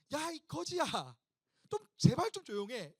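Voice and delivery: acted high, exasperated hushing; scolding crowd. Crowd silencing the shouting beggar, scolding. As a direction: tell off a nuisance, voice jumping up. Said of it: "사람들이 뭐라고 하냐면".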